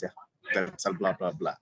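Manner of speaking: normal talking voice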